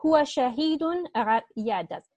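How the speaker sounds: usual speaking voice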